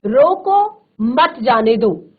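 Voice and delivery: slow रोको, a clear break, then मत जाने दो; pause after रोको. Shown as a demonstration. Teacher showing the first sentence to students, pause after रोको. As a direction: stop after रोको and say मत जाने दो as one order. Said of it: "जैसे पहला वाक्य आप देख रहे हैं".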